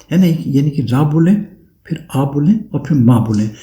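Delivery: ordinary talk, nothing marked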